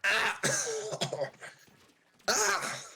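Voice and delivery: high startled squeal; excited squeal. Acted. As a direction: squeal in surprise. Fan shrieks at seeing Brock.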